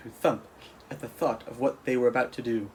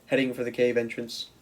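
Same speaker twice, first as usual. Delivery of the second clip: usual speaking voice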